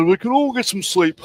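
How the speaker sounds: lightly voiced posh gent; a hint of upper-class accent. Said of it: "his best posh voice for you".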